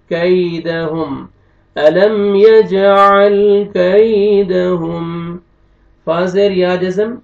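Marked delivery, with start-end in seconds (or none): 1.8-5.4 slow, melodic tajweed chant, louder; slow Quranic recitation. Shown as a demonstration. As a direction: recite the verse in a drawn-out chant.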